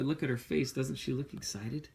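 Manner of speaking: plain reading voice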